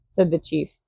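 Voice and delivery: plain reading voice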